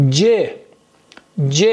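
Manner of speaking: letter C said "ج", as je; Turkish letter name. Shown as a demonstration. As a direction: say "ج" with a j sound, je. («ج» به صورت جِ ترکی)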